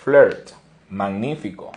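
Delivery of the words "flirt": "Flirt" repeated loudly, vowel said as "er"; demonstrated English pronunciation. Shown as a demonstration. Teacher repeats for learners, loud "Flirt" with "er" vowel. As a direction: punch out "Flirt" as a loud "flert". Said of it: "decimos flirt".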